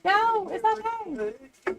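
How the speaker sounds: drawn-out high-pitched pet-talk; reassuring a dog. Real, not acted. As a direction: coax the nervous dog gently. Soothing her scared dog.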